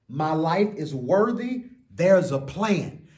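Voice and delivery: put-on an expressive children's book reading; story reading. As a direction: read it like a bedtime picture book. Reads picture book aloud.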